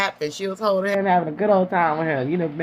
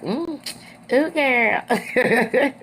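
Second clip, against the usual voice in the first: amused ooh; 6 semitones higher, much slower than usual. Retold or imitated. Melody reacting to Stormy's threat.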